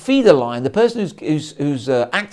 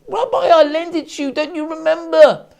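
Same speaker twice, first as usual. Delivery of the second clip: high, louder, exasperated insistence; exasperated pleading; 11 semitones higher, 4 dB louder than usual. Acted. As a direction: press him, frustrated he won't remember. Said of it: "The fellow's, now getting exasperated".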